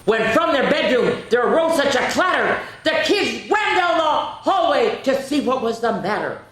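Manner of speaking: high, loud, excited read-aloud; dramatic narration. A narrator's reading. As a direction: build the drama for the kids. Dramatic story climax.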